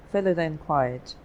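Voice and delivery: ordinary delivery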